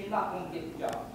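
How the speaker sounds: usual speaking voice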